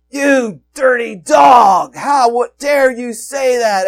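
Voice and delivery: slow, loud scornful shout, pitched high; angry brothers. Retold or imitated. Brothers scolding Joseph for his dream, angry shout. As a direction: shout him down in disgust. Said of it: "They're like".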